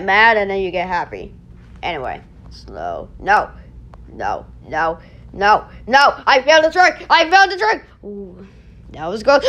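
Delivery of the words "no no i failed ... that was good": ordinary delivery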